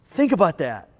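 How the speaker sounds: ordinary talk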